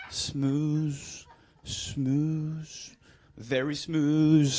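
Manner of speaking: playing the suave charmer; mock seductive. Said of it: "demonstrating the attractive walk".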